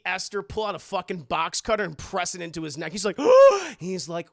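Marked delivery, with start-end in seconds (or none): 3.2-3.7 high, loud mock gasp; sharp acted gasp; terrified gasp. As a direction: gasp awake in fright. Boy wakes with blade at neck.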